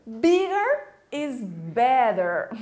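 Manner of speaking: slow, loud, drawn-out slogan; playful slogan. Acted. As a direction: relish each word, stretched out. Playful slogan after uhul.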